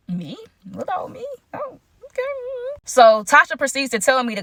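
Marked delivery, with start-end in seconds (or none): 0.1-2.2 tiny, high, hushed voice; coy surprise. Acted. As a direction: squeak it softly, playing coy and flattered. Plays herself reacting to flattering news.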